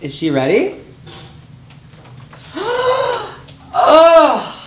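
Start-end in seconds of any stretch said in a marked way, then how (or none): 0.8-3.7 big gasp. Real, not acted. Reveal of drag look. 3.7-4.6 amazed cry. Real, not acted. Reveal of drag look.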